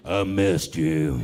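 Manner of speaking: very low, very slow creepy murmur. Retold or imitated. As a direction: drag it out deep and unsettling. Creepy line to date.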